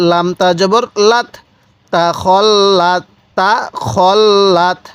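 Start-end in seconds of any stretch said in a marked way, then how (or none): 1.9-4.8 slow, drawn-out তা খল লাত; model recitation. Shown as a demonstration. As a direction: hold each syllable of তা খল লাত, separately. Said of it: "এরকমভাবে".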